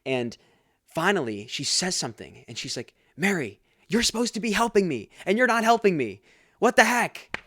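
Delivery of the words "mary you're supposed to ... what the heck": loud, high-pitched exasperated scolding; acted anger. Martha finally confronting Mary, acted angry outburst. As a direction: let the frustration burst out, louder on each phrase. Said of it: "She's getting angry".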